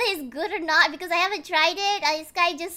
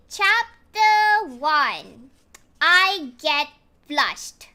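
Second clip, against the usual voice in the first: slow chapter announcement; 5 dB louder, much slower than usual. Acted.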